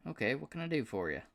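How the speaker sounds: high, excited southern drawl; a delighted good-old-boy southern twang. Acted. Paul thrilled to hear from Aston, southern drawl. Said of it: "with a surprisingly thick southern drawl".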